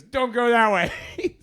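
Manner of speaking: high, slow warning broken by laughter; amused slowed-down caution; laughing warning. Retold or imitated. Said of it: "George grabs me and goes".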